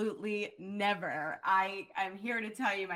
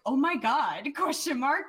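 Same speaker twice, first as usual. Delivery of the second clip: excited disbelief; 6 semitones higher, 5 dB louder than usual. Retold or imitated. Texting PR guy in disbelief.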